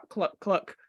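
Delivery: low clucking like a hen; chicken cluck. Acted. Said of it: "random animal sounds".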